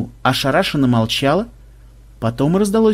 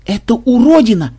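horrified cry; 9 semitones higher, 6 dB louder than usual. A narrator's reading. Melissa horrified.

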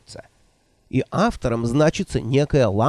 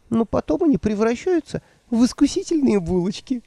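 put-on higher, fluttery old woman voice; 10 semitones higher than usual. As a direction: play the flustered old witch. Nanny voiced by narrator.

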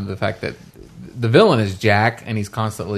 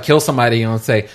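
ordinary talk, nothing marked

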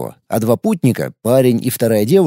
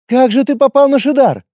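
surprised; 11 semitones higher than usual. A narrator's reading. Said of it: "удивилась она".

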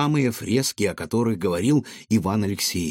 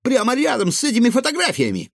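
very high, loud squeaky exclamation; shrieked announcement from a small creature; excited cry; 14 semitones higher, 5 dB louder, faster than usual. Acted. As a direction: cry out the alarming discovery. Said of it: "возбуждённо вскрикнул Гаврош".

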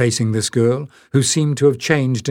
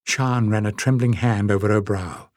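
ordinary delivery, nothing marked

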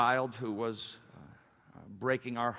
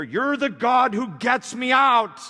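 loud, high, drawn-out declaration; impassioned cry; 12 semitones higher, 12 dB louder, slower than usual. Acted. Preacher as David praising his rescuer, impassioned cry. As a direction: belt it up to God.

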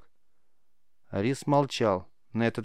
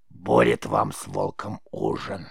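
slow, low, creaky crone; croaking old witch; 11 semitones lower, slower than usual. A narrator's reading. Witch tells the boy of the dead rabbit's use, slow low croak. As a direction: draw it out in a deep rasp. Witch jeers after failed healing.

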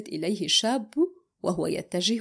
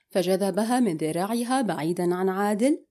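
usual speaking voice

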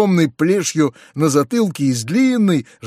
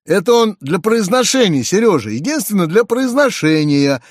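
mildly imploring, higher pitched; pleading voice; 6 semitones higher, 3 dB louder than usual. A narrator's reading. Uncle excuses the French lessons to Seryozha, mildly pleading. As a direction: lightly plead in a raised voice. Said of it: "каким-то просительным голосом".

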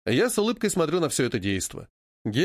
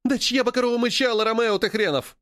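heated retort; 7 semitones higher than usual. A narrator's reading. Rivals trading insults in battle.

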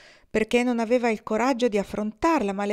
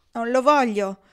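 blurted, clearly louder refusal; loud exclamation; 4 semitones higher, 7 dB louder than usual. Acted. Kendra refusing the gold from Jean, a loud blurted refusal. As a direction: push it out loud without thinking. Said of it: "esclamò lei senza riflettere".

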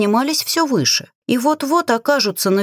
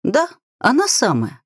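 ordinary delivery, nothing marked

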